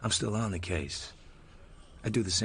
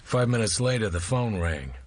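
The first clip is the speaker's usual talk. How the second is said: story narration. A narrator's reading. Marlowe narrates over music.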